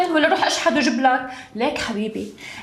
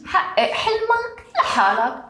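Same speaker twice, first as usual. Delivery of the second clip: mocking; 10 semitones higher, slower than usual. Wife mocks his dream.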